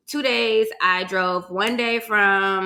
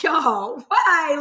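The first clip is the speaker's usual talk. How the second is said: surprised; 7 semitones higher, 3 dB louder than usual. Real, not acted. Relatives handing her money.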